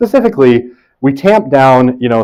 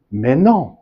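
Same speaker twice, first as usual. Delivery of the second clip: put-on French accent; 2 semitones higher than usual. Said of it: "in a terrible French accent".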